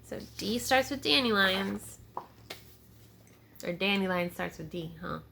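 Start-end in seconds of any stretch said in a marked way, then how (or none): none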